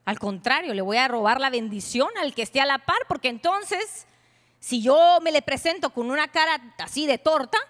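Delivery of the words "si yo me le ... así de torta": ordinary talk, nothing marked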